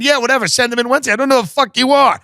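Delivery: high-pitched, hurried brush-off; acted exasperation; fed-up customer. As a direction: give in just to get the caller off the phone. Acts out fed-up phone customer.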